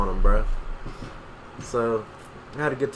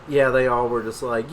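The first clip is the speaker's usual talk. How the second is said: ordinary talk, nothing marked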